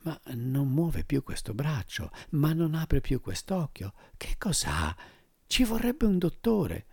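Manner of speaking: hushed anxious voices; worried relatives. Acted. Worried relatives about old man.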